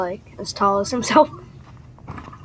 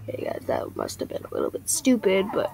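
lowest voice; 2 semitones higher, 8 dB quieter than usual. Real, not acted. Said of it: "I'm talking in the lowest voice I can".

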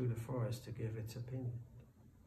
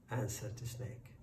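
ordinary delivery, nothing marked

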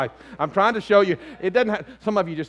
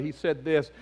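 ordinary talk, nothing marked

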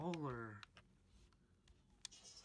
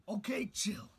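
loud; 5 semitones higher, 9 dB louder than usual. Acted. Shouted back.

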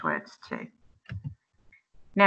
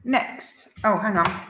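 ordinary talk

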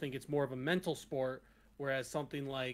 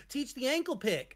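high, mock-eager student voice; students requesting; 10 semitones higher, 5 dB louder than usual. Students begging the coach, mock eager high voice. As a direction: beg like a keen student. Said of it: "they're like".

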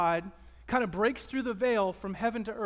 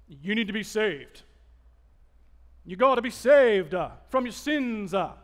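a hint of a turn-or-burn preacher, louder; mock old-school preacher; 5 dB louder than usual. Pastor mimicking old revival preachers, mild hellfire parody. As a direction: lightly parody a hellfire evangelist. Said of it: "they call it turn or burn term".

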